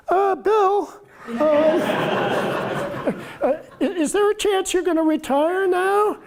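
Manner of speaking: high-pitched nervous question to a star athlete; nervous soft voice. Retold or imitated. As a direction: ask it like a nervous young reporter. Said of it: "in my very soft voice, maybe a little higher than usual 'cause I was nervous".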